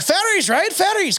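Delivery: very high, swooping agreement; mock eager agreement. Two brawlers suddenly agree on fairies, high mock eagerness. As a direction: pitch each "Fairies" way up. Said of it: "until they both mention fairies".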